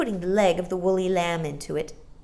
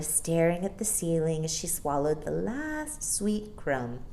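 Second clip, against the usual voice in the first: plain reading voice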